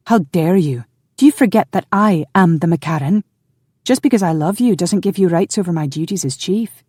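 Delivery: affronted clan chief; indignant. A narrator's reading. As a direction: pull rank in an angry rebuke. Outraged chief rebukes her husband.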